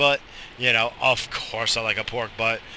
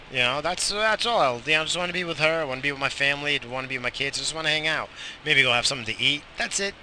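ordinary talk, nothing marked

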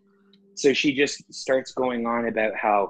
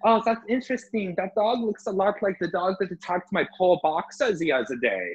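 higher, put-on prim lady voice; mock nosy lady; 8 semitones higher than usual. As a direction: play the suspicious dog owner, pitched up. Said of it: "She goes".

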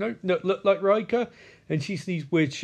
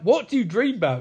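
raised pitch, acted insistence; mock pressing question; 6 semitones higher, 4 dB louder than usual. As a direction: push for an answer, playing it up. Acted interrogation, first asking.

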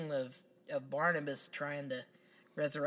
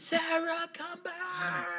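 much higher, slow overwrought wail; hammy stage actor; much slower than usual. Retold or imitated. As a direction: overact the plea like bad melodrama.

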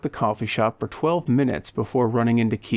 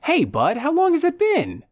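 high, excited greeting; over-friendly old pal Leo. Acted. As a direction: greet a long-lost buddy with eager surprise.

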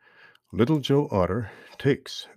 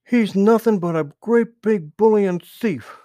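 high, loud angry outburst; furious young otter yelling; angry declaration; 11 semitones higher, 8 dB louder than usual. A narrator's reading. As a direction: spit out the accusation in a rage. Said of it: "declared Little Joe".

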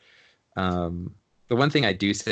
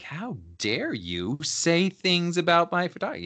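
mock indignant; 10 semitones higher than usual. Mock offended reaction to client.

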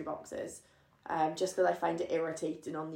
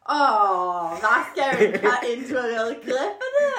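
high, loud, gushing reaction; squealing with flattered delight; 6 semitones higher, 9 dB louder, slower than usual. Real, not acted. Teasing over a sweet compliment.

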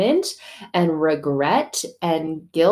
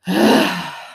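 weary sigh; 6 dB louder than usual. Retold or imitated. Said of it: "And God's like".

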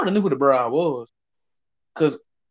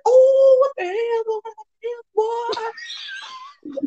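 mimicked shocked newcomer. Little new guy overhears them, high mock shock.

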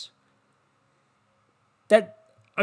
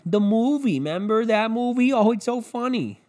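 mock gushing anchor; 6 semitones higher than usual. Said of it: "And they showed up Sunday".

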